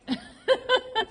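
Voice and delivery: high delighted laugh. Real, not acted. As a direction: burst out laughing with joy. Rider finally gets it right.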